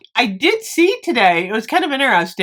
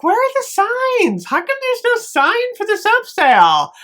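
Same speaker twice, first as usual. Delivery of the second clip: 1 baffled high voice; 13 semitones higher than usual. Retold or imitated. Confused in store, retold.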